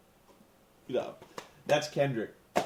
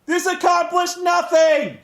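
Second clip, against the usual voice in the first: high, loud outburst of frustration; shouted, incredulous punchline; exasperated yell; 12 semitones higher, 10 dB louder than usual. Real, not acted. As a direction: explode with comic exasperation. Exasperated punchline after slow reasoning.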